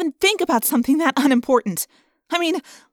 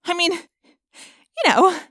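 choked up; 5 semitones higher than usual. A narrator's reading. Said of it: "I felt like I was choking".

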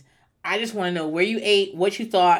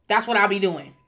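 usual speaking voice

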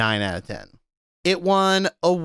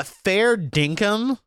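fair dinkum, higher and slow, Aussie tune; Aussie slang; 7 semitones higher, slower than usual. Shown as a demonstration. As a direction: drawl fair dinkum like a local. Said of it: "It means yeah, honestly".

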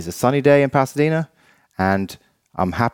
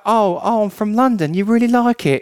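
very high, put-on London English accent; 11 semitones higher than usual. London friend lapping up the driver's praise, imitated London accent. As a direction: play up being a flattered Londoner, high-pitched. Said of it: "and Phil's like".

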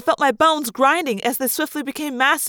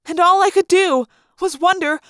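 mounting dread; 6 semitones higher, 5 dB louder than usual. A narrator's reading. Narrator drowning, rising panic.

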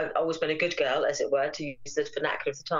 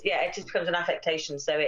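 ordinary talk, nothing marked

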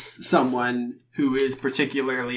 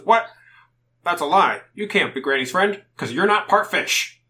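a hint of an indignant fish man; loud, accusing fishman voice; suspicious fish guy; 5 semitones higher, 6 dB louder than usual.

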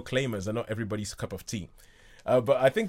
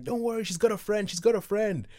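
high, put-on voice of an eager mate; pitched-up, insistent mock reassurance; reassuring friend; 10 semitones higher than usual. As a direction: imitate a pal talking up a blind date. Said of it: "And his friend is like".

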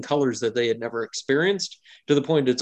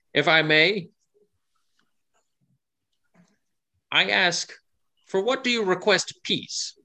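indigo gnome asking; 7 semitones higher than usual. Acted. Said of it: "he leans forward and he says".

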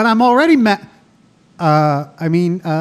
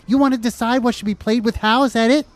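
usual speaking voice

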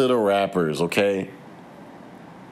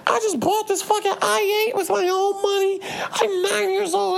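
very high mocking little-girl voice; squeaky bratty kid bragging, imitated; high mock child voice flexing her clothes. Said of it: "I just seen a video today, like".

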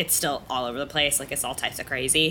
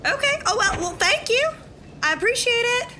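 Leslie's flustered voice. Acted. Leslie thanks the rushing teen in a high flustered voice. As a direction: squeak out surprised thanks. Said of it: "slightly alter my voice".

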